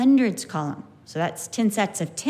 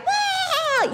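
put-on kids cheering. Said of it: "the class would be like".

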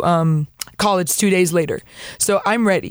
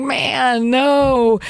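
high, long-drawn protesting groan; mock protest; 5 semitones higher than usual. To God, resisting the call to stay, mock-protesting wail. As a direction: drag it out high, playfully exasperated.

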